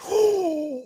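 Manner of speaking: long, high gasp; near-miss gasp. Real, not acted. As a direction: suck in breath as the shot misses. Shot narrowly misses.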